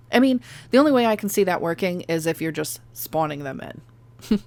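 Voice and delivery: ordinary talk, nothing marked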